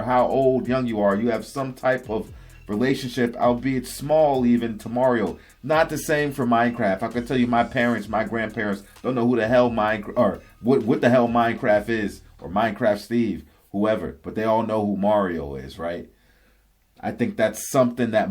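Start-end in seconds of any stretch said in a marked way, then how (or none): none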